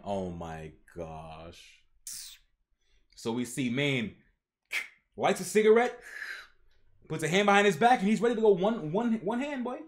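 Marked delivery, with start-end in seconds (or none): none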